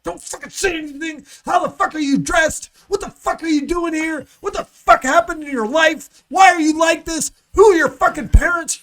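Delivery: loud, high, furious berating; yelling judge. Retold or imitated. Judge yelling at a defendant in court, a loud furious tirade. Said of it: "they're like".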